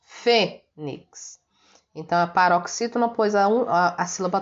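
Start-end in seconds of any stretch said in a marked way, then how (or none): 0.0-1.4 fênix split, fê strong and nix falling away; syllable demo of fênix, fê strongest; stress on FÊ. Shown as a demonstration. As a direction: lean on fê, then a light nix. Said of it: "O mais forte é o F E".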